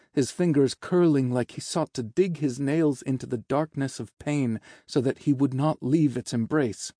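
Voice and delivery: ordinary delivery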